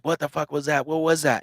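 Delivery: imitating a terrified friend's frantic questions; retold panicked yell. Friend jumps from his chair after hearing a growl, mild mock panicked yell. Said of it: "And he's screaming".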